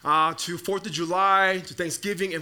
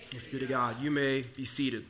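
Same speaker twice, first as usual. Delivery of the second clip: usual speaking voice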